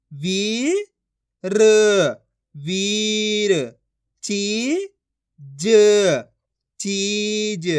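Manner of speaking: each syllable stretched long and held; very slow syllable-by-syllable reading demo; drawn-out pronunciation. Shown as a demonstration. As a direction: draw out every vowel like a reading teacher. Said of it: "आपको खींच के पढ़ना है".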